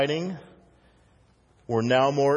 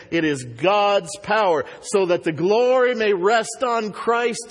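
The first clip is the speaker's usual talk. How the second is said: higher, building sermon voice driving each line; rising preaching; 6 semitones higher than usual. Acted. Preacher urging believers they have great power, fervent build. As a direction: press the congregation with it, building.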